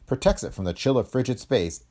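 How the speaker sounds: plain reading voice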